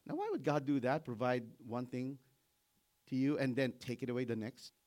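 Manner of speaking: ordinary delivery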